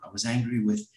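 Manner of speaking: ordinary delivery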